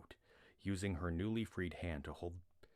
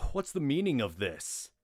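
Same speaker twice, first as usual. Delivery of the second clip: loud, higher, indignant command; stern demand; 8 semitones higher, 9 dB louder than usual. Acted. Glawin demanding the constable explain himself, loud. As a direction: demand an answer with authority. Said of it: "demanded Glawin".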